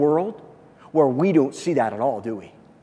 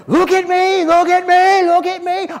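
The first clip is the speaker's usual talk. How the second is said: much higher, louder bragging call for attention; boastful show-off; 13 semitones higher, 7 dB louder than usual. Retold or imitated. Preacher mocking a show-off, much higher bragging shout. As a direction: crow it like a show-off begging to be watched. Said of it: "Jesus didn't come and say".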